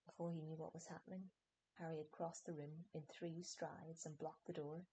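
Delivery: plain reading voice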